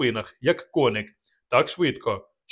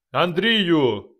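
high, loud bellowed name; thundering call; 5 semitones higher, 4 dB louder than usual. Acted. Dubatovk bellowing at Andrei from the burning house. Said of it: "гримів голос Дубатовка".

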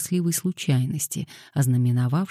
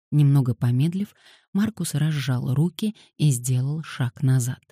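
ordinary delivery

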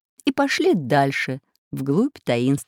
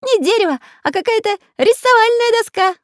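much higher, chirpy character voice; high cartoon voice; 6 dB louder than usual. A narrator's reading. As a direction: squeak it out like a toy pencil. Magic pencil character speaks.

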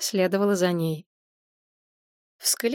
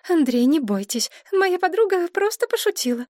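Maria soothing; 10 semitones higher than usual. A narrator's reading.

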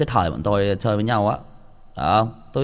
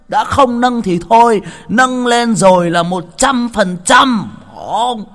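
higher and louder, slow emphatic drinking chant; hyped-up boast; 10 semitones higher, 7 dB louder, slower than usual. Acted. Telling the guys how she drank, loud hyped-up boast. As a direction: pump it up like a drinking toast.

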